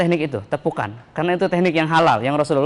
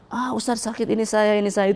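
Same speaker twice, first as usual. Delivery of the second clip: mock pained cry; 5 semitones higher, 4 dB quieter than usual. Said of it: "dia teriak".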